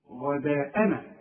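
غداءنا said slowly, with a thin wrong ghain; slow demo of غداءنا done the wrong way; slow wrong reading. Shown as a demonstration. Teacher warning students, slowly saying غداءنا wrong. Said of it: "ما تقولش غداءنا".

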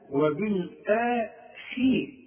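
وبالآخي with a light, thin خ under kasra, slow; slow, light kha in وبالآخي; correct kha. Shown as a demonstration. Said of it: "شوف، مش وبالآخي". (خاء مرققة مكسورة في وبالآخي)

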